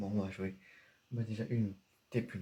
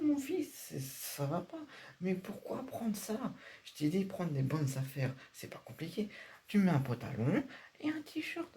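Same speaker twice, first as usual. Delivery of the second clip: a father aghast at the pyjama outfit; exasperated father; 4 semitones higher than usual. Acted. Father scolding his son over the pyjama outfit, high exasperated voice.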